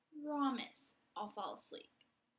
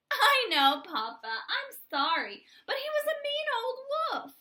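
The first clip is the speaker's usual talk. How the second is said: loud, much higher, rushing at the end; excited protest; 11 semitones higher, 9 dB louder than usual. Acted. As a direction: insist breathlessly he was mean. Chicken protests to Papa.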